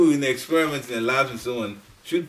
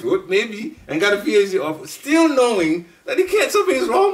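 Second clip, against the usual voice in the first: rising laugh through the words, higher and louder; 8 semitones higher, 5 dB louder than usual. Real, not acted. Telling the congregation how he got his PhD, laughing. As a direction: laugh ruefully as you tell it. Recalling writing maybe for PhD.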